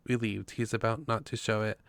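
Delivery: usual speaking voice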